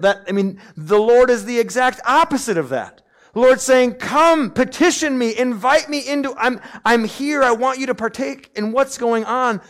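loud, high, driving sermon voice; full-force preacher; forceful preaching. Acted. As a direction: preach it hard to the congregation.

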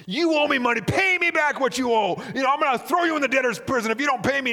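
angry demand. Acted. Servant shaking a fellow servant, furious shouting demand. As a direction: shout him down while shaking him.

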